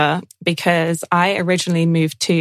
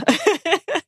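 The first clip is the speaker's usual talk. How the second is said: high giggle; 12 semitones higher than usual. Real, not acted. Keeps laughing after the joke.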